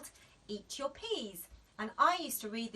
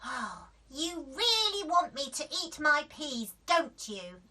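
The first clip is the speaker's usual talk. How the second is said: high, louder teasing; sly realization; knowing child; 5 semitones higher, 6 dB louder than usual. A narrator's reading. Daisy catching on, teasing mum. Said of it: "said Daisy".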